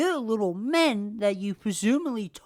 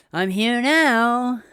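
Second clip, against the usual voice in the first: mock smug; 4 semitones higher, 7 dB louder, slower than usual. Father's smug apology mocked.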